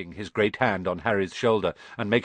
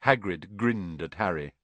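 ordinary delivery, nothing marked

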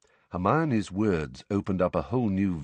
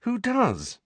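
high, pleading question; slightly desperate. A narrator's reading. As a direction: ask with mild desperation, pitched right up. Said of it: "said Harry slightly desperately".

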